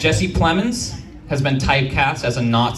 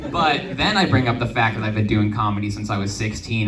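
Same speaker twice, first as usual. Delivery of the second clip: usual speaking voice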